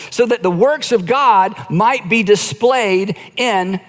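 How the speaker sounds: slow, weighty scripture quote; slow deliberate reading. Shown as a demonstration. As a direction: read each word slowly and clearly. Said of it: "he gives us the".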